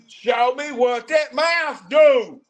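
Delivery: high, slow hype shout of the slogan. Real, not acted. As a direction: belt out the slogan like a hype man. Hyping the shirt slogan.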